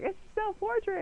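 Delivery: high, sing-song teasing; teasing friends. Retold or imitated. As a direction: tease like her friends did. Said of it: "everyone was like".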